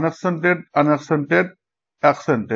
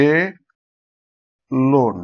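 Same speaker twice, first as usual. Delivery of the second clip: "এ লোন" with a long pause between the halves; broken into syllables; 2 semitones lower than usual. Shown as a demonstration. Teacher breaking up alone, syllable by syllable. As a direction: split "এ লোন" into two isolated beats. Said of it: "অ্যালোনও ভাঙবে".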